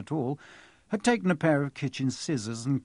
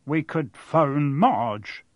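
Uncle Vernon; 3 dB louder, much slower than usual. A narrator's reading. Vernon voiced, slow.

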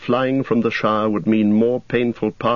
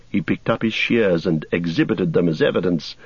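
ordinary delivery

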